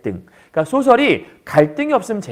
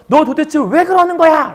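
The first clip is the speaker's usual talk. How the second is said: higher, louder scolding question; angry demand; 10 semitones higher, 5 dB louder than usual. Retold or imitated. Father-in-law demanding why the son-in-law acts up, angry. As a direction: snap at him like an irate elder.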